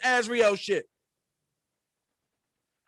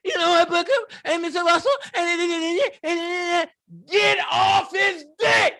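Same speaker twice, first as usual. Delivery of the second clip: whiny crying voice; 6 semitones higher than usual. Retold or imitated. Acted outburst, high and crying.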